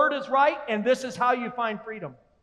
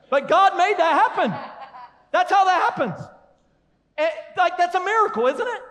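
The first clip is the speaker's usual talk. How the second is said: laughing; 7 semitones higher than usual. Real, not acted. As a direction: chuckle at the joke. Laughing at own joke.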